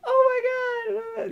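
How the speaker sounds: put-on girl squealing with delight after the kiss; thrilled girl. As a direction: gush like a thrilled teen girl.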